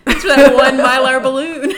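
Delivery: half-shouted line through laughter; laughing. Real, not acted. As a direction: laugh it out loud. Laughing at the proposal.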